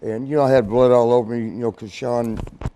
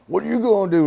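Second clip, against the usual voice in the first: raised, higher-pitched angry challenge; retold angry dare, pitched up and loud; pissed off; 8 semitones higher, 5 dB louder, faster than usual. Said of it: "I was still pissed off".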